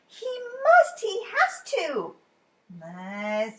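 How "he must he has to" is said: Ted pleading. A narrator's reading. Ted insisting he is real.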